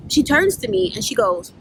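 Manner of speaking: normal talking voice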